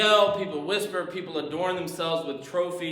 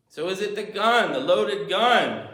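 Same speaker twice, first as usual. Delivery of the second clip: ordinary delivery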